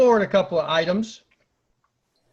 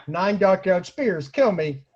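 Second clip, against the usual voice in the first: ordinary delivery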